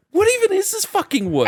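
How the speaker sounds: very high, incredulous exclamation; baffled retelling. Retold or imitated. Player baffled by intense Roblox roleplay, high incredulous voice. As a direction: act out disbelief, pitch leaping up.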